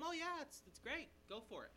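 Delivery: high, breezy mock reassurance; glib approval. Levite telling the spies what they want, glibly approving. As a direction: wave them on without a thought.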